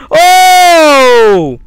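loud startled yell; shocked shout. Real, not acted. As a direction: scream in fright. Jump scare shout.

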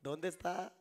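normal talking voice

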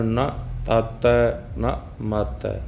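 ਨਾ ਤਾਤੈ ਨਾ ਮਾਤੈ said slowly, ਨੰਨਾ short and light; drawn-out teacher model of ਨਾ ਤਾਤੈ ਨਾ ਮਾਤੈ, each word clear; slow careful recitation. Shown as a demonstration. Gurbani ਸਿਖਾਉਂਦਾ ਅਧਿਆਪਕ ਹੌਲੀ ਹੌਲੀ ਸਹੀ ਉਚਾਰਨ ਦਿਖਾਉਂਦਾ. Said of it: "ਇਸ ਤਰ੍ਹਾਂ ਹੀ ਪੜ੍ਹਨਾ ਹੈ".

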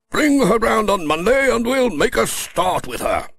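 high, brisk colonel voice; Colonel Forbes' voice. Acted. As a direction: lift the pitch for the pleased colonel.

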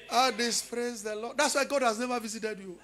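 ordinary talk

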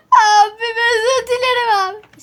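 playful wailing plea; mock pleading. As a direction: beg big brother for forgiveness, hammed-up. Apologising in the game.

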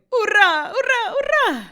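loud, very high hurrahs; joyful shouts. A narrator's reading. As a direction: cheer with all your might. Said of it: "Його радісні вигуки".

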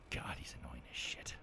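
soft breathy aside; whisper. Real, not acted. Gamer griping about annoying rival Flint, whispered aside.

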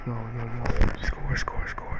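hushed, whispered urging; under-the-breath egging on; urgent whisper. Real, not acted. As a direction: whisper it over and over, barely voiced. Urging score under his breath.

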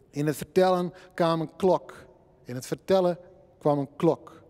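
measured poem reading, line echoed by line; bilingual poem recital. Acted. Recites poem, Frisian and Dutch alternating. (gedragen tweetalige gedichtvoordracht)

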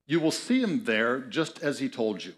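ordinary talk

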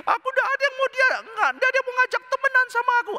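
very high, fast whiny wail; hammed-up tearful complaint, words tumbling out; mock crying. Shown as a demonstration. Preacher mimics friendless person complaining, mock crying. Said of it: "Ada orang nangis demikian".

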